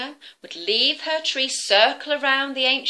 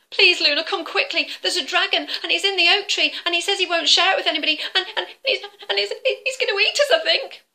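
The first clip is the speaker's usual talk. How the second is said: high, fast breathless babble; panicked squirrel; 9 semitones higher, 5 dB louder, faster than usual. Acted. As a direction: gabble it out in a fright. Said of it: "squirrel panics to Luna".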